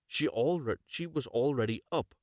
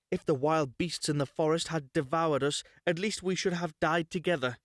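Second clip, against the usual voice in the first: put-on lamenting girl; 6 semitones higher than usual. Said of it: "she cried".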